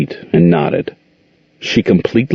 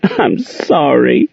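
slow, higher tearful apology; sobbed; 7 semitones higher, much slower than usual. A narrator's reading. Monique sobbing her apology to Aunt Queen, slow and tearful. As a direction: sob it out, barely holding together. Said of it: "Monique sobbed".

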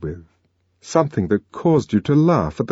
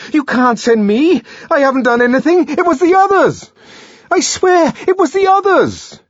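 shrill, much louder desperate protest; panicked pleading; 7 dB louder than usual. A narrator's reading. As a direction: plead for your life. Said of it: "violent and unseemly activity".

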